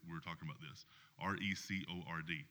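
ordinary delivery

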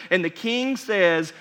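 ordinary delivery

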